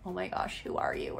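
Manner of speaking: hushed, under-the-breath disbelief; retold wary aside. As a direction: imitate a kid's silent bafflement, very softly. Boy's wary reaction to stranger.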